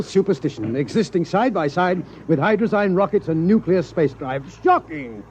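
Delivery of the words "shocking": shocked. Acted. Scientist scandalised by superstition.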